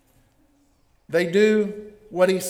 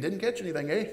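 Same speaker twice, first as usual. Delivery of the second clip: put-on Canadian accent; 9 dB quieter, much faster than usual. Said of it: "Or if you're Canadian".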